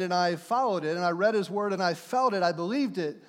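ordinary talk, nothing marked

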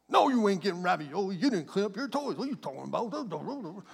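mock mom tirade running into babble; tired mom ranting. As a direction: scold like a worn-out mother, hammed up. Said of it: "Oh, Mom's tired again".

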